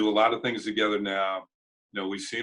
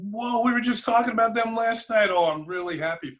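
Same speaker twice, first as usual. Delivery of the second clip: high, excited burst easing down, retold; happy exclamation to a friend; excited congratulation; 13 semitones higher, 4 dB louder than usual. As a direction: retell it with delighted surprise, starting high.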